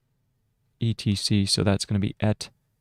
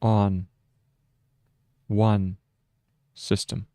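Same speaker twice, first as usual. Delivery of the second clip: dictating. Shown as a demonstration. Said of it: "so that's period".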